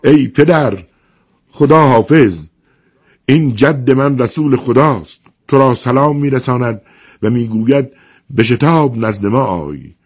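crying out. A narrator's reading. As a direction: call out to your father, high and urgent. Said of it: "فریاد زد". (فریاد بلند وداع با پدر)

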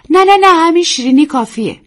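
high, loud quick no no no; hasty refusal. Acted. Young wife declines tea to hostess, hasty and high. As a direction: wave it off in a rush.

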